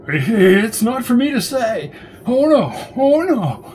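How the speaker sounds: high, wailing, drawn-out protest; crazed refusal. Acted. Frightened suspect refusing to tell police, crazed high wail. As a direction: wail slowly in a cracked-up falsetto.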